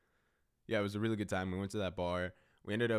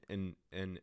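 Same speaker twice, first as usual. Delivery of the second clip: ordinary talk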